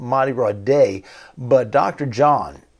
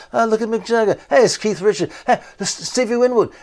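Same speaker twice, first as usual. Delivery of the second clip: higher, bright exclamations over each photo; 9 semitones higher, 4 dB louder than usual. Retold or imitated. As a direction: point at each shot with delight.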